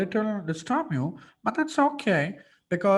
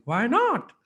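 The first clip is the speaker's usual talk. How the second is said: higher "Why not?" with "not" arching up and falling; voice modulation; 7 semitones higher, 4 dB louder than usual. Shown as a demonstration. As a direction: throw "not" up high, then let it drop. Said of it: "But I'm not saying".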